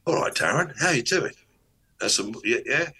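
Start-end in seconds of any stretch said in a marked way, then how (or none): 0.1-1.3 higher, cheerful greeting; bright happy hello; happy energy. Shown as a demonstration. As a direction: greet him like you're delighted. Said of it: "I can do a happy energy".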